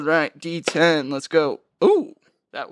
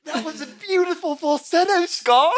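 high character voice; 9 semitones higher than usual. Acted. Teasing Scar after his voice cracked roaring, high mock praise. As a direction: praise it in a pitched-up, hammed-up voice.

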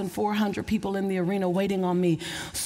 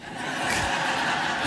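breathy laugh. Real, not acted. Laughing at herself.

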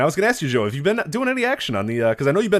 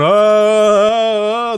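hammed-up fan yelling "Oh", louder and higher; mock fan cheer; 8 semitones higher, 8 dB louder than usual. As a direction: shout "Oh" like a fan jumping up and down. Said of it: "jumping up and down going".

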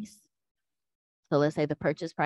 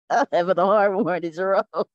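laughing; 5 semitones higher, 7 dB louder than usual. Real, not acted. Tongue-tied, laughing at herself.

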